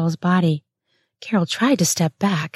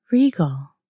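ordinary delivery, nothing marked

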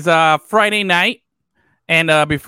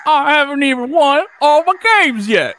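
mock Southern accent; 8 semitones higher than usual. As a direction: mimic the coach's fake Southern twang, laughing. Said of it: "homeboy just said, "Yo, I am going full".

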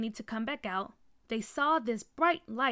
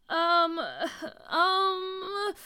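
nervous stalling; 7 semitones higher, 4 dB louder than usual. A narrator's reading. Mickey put on the spot.

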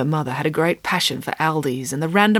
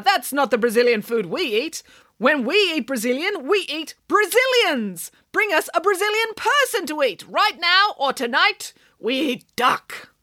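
high-pitched piranha voice, slowing into a demand; rude, ungrateful piranha; 8 semitones higher than usual. A narrator's reading. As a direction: threaten the ducks as an affronted piranha. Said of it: "very rude and ungrateful".

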